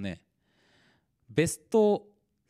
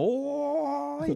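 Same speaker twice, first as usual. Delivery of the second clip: very slow, high, drawn-out call; old man calling out; 14 semitones higher, much slower than usual. Retold or imitated. Old man calling across fifty metres, a long high hail. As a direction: stretch it out like hailing someone far away. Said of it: "っていうさ".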